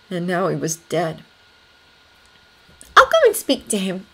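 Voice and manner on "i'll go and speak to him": higher-pitched, quick offer; said quickly. A narrator's reading. Anna hurriedly offering to follow Thomas out, said quickly. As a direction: jump in promptly, in a higher voice. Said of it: "Anna said quickly".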